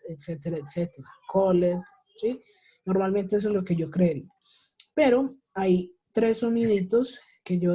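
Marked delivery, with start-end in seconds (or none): 1.3-1.8 "called" in two syllables, "col-led"; pronounced as spelt. Shown as a demonstration. Teacher shows learners' expected "called", read as two syllables. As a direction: sound out the E in "called". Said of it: "se pronuncia tal y como se ve".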